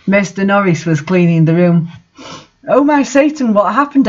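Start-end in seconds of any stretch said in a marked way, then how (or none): 2.7-4.1 high, mild mock scream. A narrator's reading. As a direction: shriek it in a light hammed-up way. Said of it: "I screamed".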